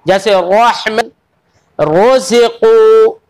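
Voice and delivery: रहमत and रोज़िक़ू slow with a full ra; heavy ra. Shown as a demonstration. Teacher gives examples of heavy ra, slowly. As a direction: recite रोज़िक़ू slowly, ra thick. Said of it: "यानी मोटी पड़ी जाएगी".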